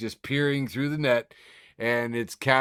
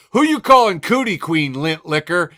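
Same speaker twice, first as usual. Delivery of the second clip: high, loud playground insult; mock schoolyard taunt; 6 semitones higher, 10 dB louder than usual. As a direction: fling it like a kid in a gum ad. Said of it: "She's like".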